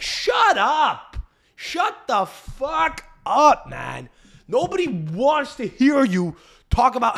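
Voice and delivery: high, drawn-out "shut up"; exasperated outburst. Acted. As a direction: stretch each word out in high exasperation. Mock outburst at proud Italian Americans.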